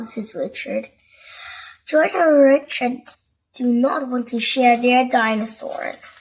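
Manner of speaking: plain reading voice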